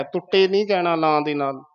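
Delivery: ordinary talk, nothing marked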